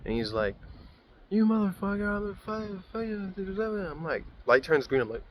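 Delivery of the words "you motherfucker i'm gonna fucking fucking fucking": high mock rant fading softer; mimicked distant road-rage yelling, dying away; imitated muffled raging driver. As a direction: rant high, trailing into half-heard swearing. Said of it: "I can barely hear him".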